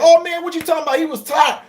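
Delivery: playful shouted rebuttal; mock heated comeback. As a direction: snap back at your buddy over the game. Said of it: "we would go back and forth like that".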